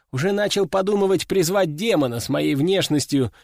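ordinary delivery, nothing marked

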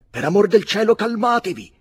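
grido acutissimo e fortissimo; grido dell'avvocato. A narrator's reading. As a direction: strilla in preda al panico. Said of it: "gridò l'avvocato a Lady Lindon". (l'avvocato grida a Lady Lindon, acuto e spaventato)

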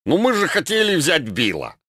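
put-on Russian with an English accent. A narrator's reading. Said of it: "с английским акцентом воскликнул Ельцин".

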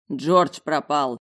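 loud, slow growl over the shoulder; growled announcement. A narrator's reading. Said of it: "рыкнул он через плечо". (рыкнул громко и медленно)

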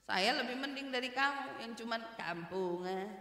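ordinary delivery

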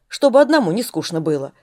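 ordinary delivery, nothing marked

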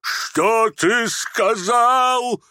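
high, long-drawn roar of outrage; thundering. Acted. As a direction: thunder the question, stretching every word. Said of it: "загремел генерал". (грозный протяжный рёв)

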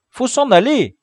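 high, loud burst of alarm; uneasy high-pitched plea; nervous urging. A narrator's reading. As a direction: urge them to leave, voice jumping up. Frightened boy urges retreat.

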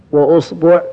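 وأصبع said "wa-usbu'", u in both syllables; u on the hamza, then "bu'"; u-u vowels. Shown as a demonstration. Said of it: "ضم الهمزة".

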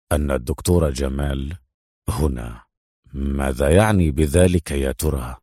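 low, pondering inner monologue; thinking to himself. A narrator's reading. Adham puzzles over where Doctor Jamal is, pondering. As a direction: reason it out privately, pitched well down. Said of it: "وأخذ يفكر".

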